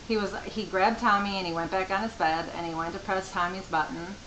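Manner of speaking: ordinary talk, nothing marked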